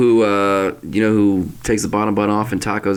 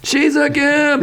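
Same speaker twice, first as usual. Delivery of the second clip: high mocking sitcom voice; slower than usual. Voicing sitcom character.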